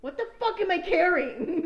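high, loud, laughing disbelief; loud laughing shock at a heavy bag; laughing outburst. Retold or imitated. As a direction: blurt it out cracking up. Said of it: "and I'm like".

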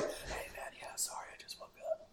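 whispered, half-asleep excuse; fake sleepy voice. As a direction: pretend you have just woken up. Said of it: "You were like".

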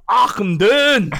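very high, loud drawn-out holler in Scottish; Scottish dwarf accent. Retold or imitated. Recalling him and Joshua flying past, Scottish dwarf holler. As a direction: sing it out long like a Scottish dwarf. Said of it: "we would go".

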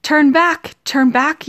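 high, loud warning call; bird's warning cry. Acted. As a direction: cry out like a caged bird. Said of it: "a bird in a cage which cried".

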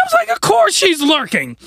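high-pitched indignant outburst; high, strained mini-scream; mock screaming. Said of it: "do a little screaming there".

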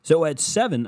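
a second, loud but botched TV anchor tease; failed news voice. Pretend. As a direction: try the newsreader sign-off again and miss. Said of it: "Terrible impression".

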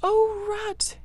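relieved, scared little creature; frightened cry. A narrator's reading. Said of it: "he cried".

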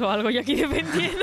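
laughs through the words, high and loud; laughing. Real, not acted. Friend laughing at herself for defending the politician. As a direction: breaks into a real laugh mid-line.

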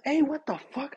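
high-pitched retold protest; alarmed protest. Man protesting to girlfriend choking him, alarmed. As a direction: act out a startled objection. Retelling being choked hard.

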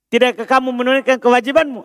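usual speaking voice

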